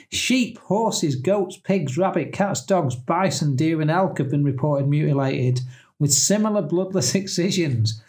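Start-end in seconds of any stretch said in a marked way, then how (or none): none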